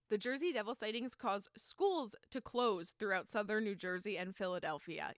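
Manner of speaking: ordinary delivery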